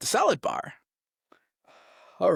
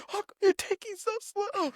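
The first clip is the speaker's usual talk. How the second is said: high mocking sergeant voice; mock drill sergeant; imitating drill sergeant; 4 dB quieter than usual. Sergeant rushing recruit.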